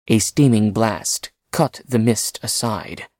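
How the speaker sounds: ordinary delivery, nothing marked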